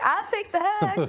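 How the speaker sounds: high, slow mocking quote. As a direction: ham up his greedy tone. Mocking boyfriend's motives.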